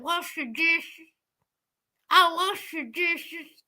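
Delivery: put-on little boy's slow, thick Southern drawl; thick Southern accent. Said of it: "with that thick Southern accent".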